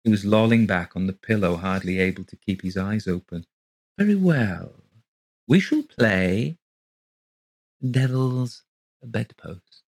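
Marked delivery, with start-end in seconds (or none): 4.0-9.8 slow, deliberate sinister invitation; lightly voiced old man; slow eerie host. A narrator's reading. Colgate proposes the game.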